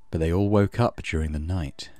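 ordinary talk

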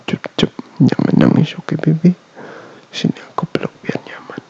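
soft, slow whisper lulling her to sleep; hushed goodnight boyfriend whisper; sleepy whisper. Acted.

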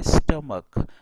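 स्टमक ending in a hard "k"; correct pronunciation. Shown as a demonstration. Teacher demonstrating the pronunciation to students, स्टमक with final "k". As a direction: say स्टमक with CH sounded as "k". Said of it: "इसका pronunciation होता है".

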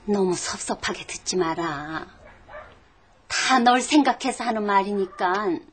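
quiet tender persuasion; gentle reassurance. Acted. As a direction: ease the blow kindly and quietly. Gently sending the boy away.

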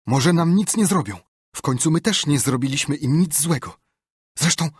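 high, loud scared voice; trying to stay calm but terrified; frightened pleading. Acted. Huck frightened, tries to calm.

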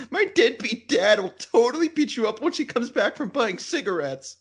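high bratty voice, laughing through it; whiny teen threat with laughs. Retold or imitated. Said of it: "that whiny teen voice".

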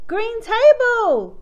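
loud, high, drawn-out delight; excited exclamation. A narrator's reading. Susie spots a toy in the backyard, excited. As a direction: stretch it out in happy discovery. Child's delighted find in story.

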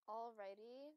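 ordinary talk, nothing marked

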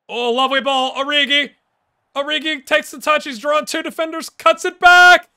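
excited play-by-play. Real, not acted. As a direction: call the attack as it builds. Live play-by-play of attack.

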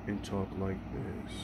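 slow right down and drop the voice low; slow, low talk. Shown as a demonstration. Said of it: "I need to slow down".

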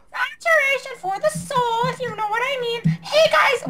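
silly high voice. Acted. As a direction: go way up into a squeak.

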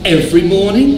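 high, rising incredulous question; disbelieving. A narrator's reading. Bear promised marmalade every breakfast, high-pitched amazed question. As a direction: ask it as if hardly believing it. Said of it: "as if he could hardly believe its ears".

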